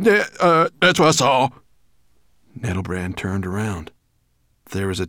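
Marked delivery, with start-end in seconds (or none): 0.0-1.5 high, halting, flustered voice; nervous dwarf. A narrator's reading. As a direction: stumble into it, high and loud. Nervous dwarf points at tapestry.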